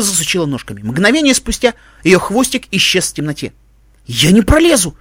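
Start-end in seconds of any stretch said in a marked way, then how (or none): 4.0-5.0 loud cry of dismay; horrified exclamation. A narrator's reading. Iskorka sees the narrow crawlway, horrified exclamation. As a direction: exclaim it in alarm. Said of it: "в ужасе воскликнула искорка".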